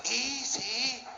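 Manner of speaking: coaxing woman. Acted. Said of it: "just say this".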